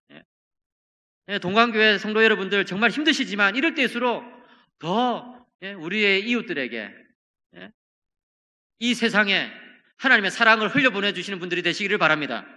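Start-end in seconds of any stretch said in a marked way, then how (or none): none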